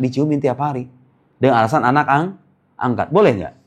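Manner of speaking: ordinary talk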